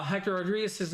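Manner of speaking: ordinary delivery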